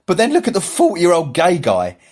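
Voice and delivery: a comedian's upbeat punchline, mildly hammed; retold comedian, perking up. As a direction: lift the voice as the punchline lands, laughing through it. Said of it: "He said".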